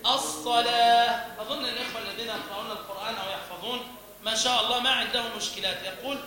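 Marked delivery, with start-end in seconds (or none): 0.1-1.4 correct pause on ha. Shown as a demonstration. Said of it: "الوقف على التاء بالهاء الساكنة". (وقف صحيح بالهاء)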